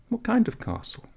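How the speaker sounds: put-on a high, urgent young girl's voice; eager village girl urging him up; young girl waking him. Girl asks curiously.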